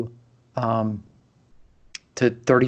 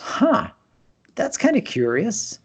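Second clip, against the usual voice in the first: a much higher, puzzled "huh" that trails off thoughtfully; curious surprise; 9 semitones higher than usual. Retold or imitated. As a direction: replay the moment you first noticed something odd. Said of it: "And I thought".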